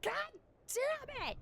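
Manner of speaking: high-pitched exasperated yell; companion's yell. A narrator's reading. Companion yelling after the player's fall, high-pitched yell. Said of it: "You hear your companion yell".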